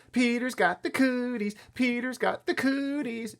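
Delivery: high, slow playground chant; teasing yell. A narrator's reading. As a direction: taunt loudly in a drawn-out sing-song. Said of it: "she yelled".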